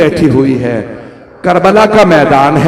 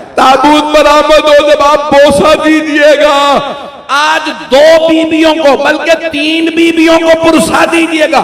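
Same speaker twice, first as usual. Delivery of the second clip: mournful majlis recitation; 6 semitones higher than usual. Acted. Preacher to mourners recounting Karbala, impassioned lament. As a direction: wail the tragedy out to the gathering.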